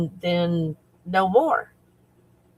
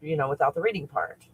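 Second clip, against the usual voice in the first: ordinary talk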